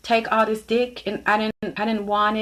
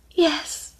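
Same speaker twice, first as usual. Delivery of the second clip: high, small mock-crying confirmation; mock tearful; 10 semitones higher than usual. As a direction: squeak it out as if crying. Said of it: "And she's crying".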